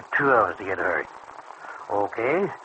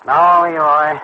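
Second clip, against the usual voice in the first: louder, flat-out no; firm refusal; 3 semitones higher, 8 dB louder, slower than usual. Acted. Stanley, turning down Leroy's money, says a firm loud no. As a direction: put your foot down.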